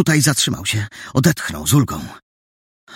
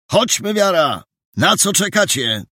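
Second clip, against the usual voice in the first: raised-pitch summons to leave; rally the others to go, voice pitched up; higher voice urging the group on; man at the door; 8 semitones higher than usual. A narrator's reading. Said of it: "powiedział ktoś od drzwi".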